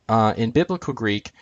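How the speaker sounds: ordinary delivery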